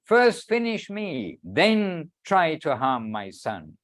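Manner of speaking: slow, emphatic declamation with sweeping pitch; measured, ringing recitation of sacred words; solemn devotional reading. Acted. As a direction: read it out grandly, lingering on each phrase. Reads quoted words of guru.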